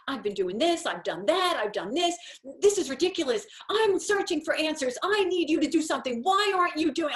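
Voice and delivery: building from firm to a loud high angry rant; rising to a yell, fed up and demanding; angry yelling at God. Retold or imitated. Speaker yells at God on her walk, building to an angry, loud, high rant. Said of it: "I'm yelling at God".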